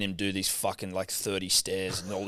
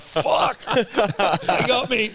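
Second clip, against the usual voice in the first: laughing mock despair; 9 semitones higher, 3 dB louder, slower than usual. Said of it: "and I'm like".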